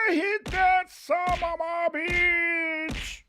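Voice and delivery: high, loud, drawn-out call for the music; hype call. Acted. Host calling for the segment jingle, stretched loud cry. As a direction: shout it out long and high like a hype man.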